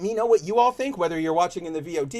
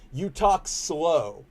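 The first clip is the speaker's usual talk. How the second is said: very drawn-out quoted remark; slow talk; much slower than usual. Retold or imitated. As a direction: stretch each word right out, imitating them. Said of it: "That's literally what people".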